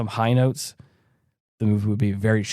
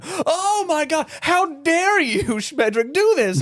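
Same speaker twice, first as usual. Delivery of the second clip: very high, hammed-up shriek at normal volume; mock outrage; slower than usual. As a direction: imitate a scandalized scold. Acted outraged scolding of the wizard.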